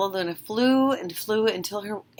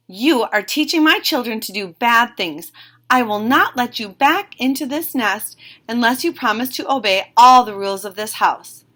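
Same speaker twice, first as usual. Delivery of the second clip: stern scolding; 3 semitones higher, 6 dB louder than usual. Acted. Mother bird scolding Stella.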